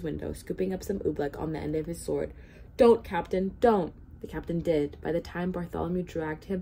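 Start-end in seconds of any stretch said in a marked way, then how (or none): 2.8-3.9 urgent plea. A narrator's reading. Bartholomew stops captain eating oobleck, urgently pleading. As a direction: cry out to stop him.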